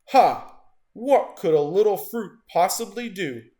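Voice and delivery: loud scornful laugh into a sneer; mocking shark. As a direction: boom it out, scoffing at the little fruit.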